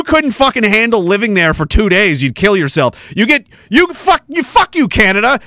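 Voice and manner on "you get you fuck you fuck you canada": angry rant. Real, not acted. Ranting at Canadians.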